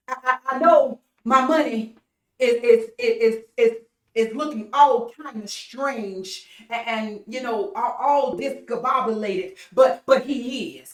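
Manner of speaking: fiery sermon climax; preaching at full force. Acted. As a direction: preach it hard and loud. Preacher builds to shout.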